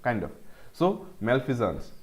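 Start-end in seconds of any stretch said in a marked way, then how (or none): 1.3-1.9 Malfeasance as "mel-fee-zuns", the z sound clear; teacher repeats Malfeasance: mel-fee-zuns; correct pronunciation. Shown as a demonstration. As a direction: model pronunciation of Malfeasance for students. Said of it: "ऐसे pronounce करो".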